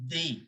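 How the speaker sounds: D said as "they", like day; soft French "they" for the letter D. Shown as a demonstration. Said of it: "this alphabet D is pronounced as".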